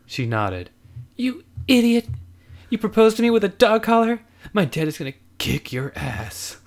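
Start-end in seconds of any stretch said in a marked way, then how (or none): 1.2-6.6 teasing woman voice. Acted. Lizzie teasing fondly.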